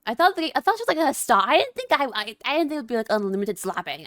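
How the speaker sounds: stunned and guilty; loud shocked complaining to chat; flustered outburst. Real, not acted. Shocked by game scene.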